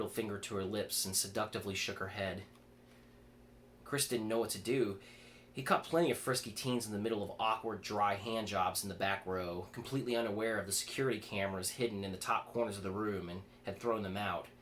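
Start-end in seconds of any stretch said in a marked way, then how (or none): none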